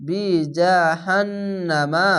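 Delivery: জাহান্নামা very slow with the doubled nun hummed long; long chanted জাহান্নামা; tajweed recitation. Shown as a demonstration. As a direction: stretch জাহান্নামা, holding the nasal নুন. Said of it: "ওয়াজিব গুণনা".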